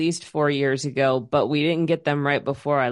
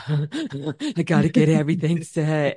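imitated frantic gasping fluster; mock panic. Host imitating their usual stress over an unset schedule, mock panic. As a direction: act out your own panicky self, breathless. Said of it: "usually that would be like".

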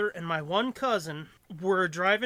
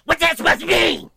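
high, loud snippy voice; venomous retort; 10 semitones higher, 12 dB louder than usual. Retold or imitated. Cousin snapping at him in the car, venomous. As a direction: spit it back with venom. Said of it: "the fucking pissiest, most venomous comment".

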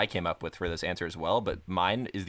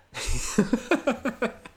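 burst of laughter. Real, not acted. Laughs after joke about booing.

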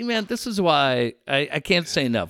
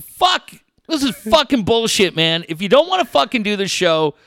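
angry rant; 7 semitones higher, 4 dB louder than usual. Retold or imitated. Retold rant at late guest.

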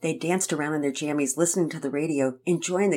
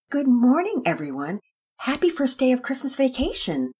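cheery mother; 9 semitones higher, 6 dB louder than usual. Acted. Mother greets children.

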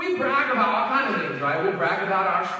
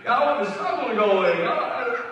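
ordinary talk, nothing marked

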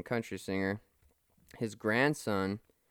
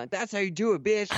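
mock badass; 9 semitones higher, 4 dB louder than usual. Badass retold taunt.

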